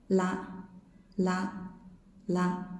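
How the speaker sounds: "La" with light tongue touch; easy flowing la; semivowel la. Shown as a demonstration. Teacher demonstrating the semivowel la.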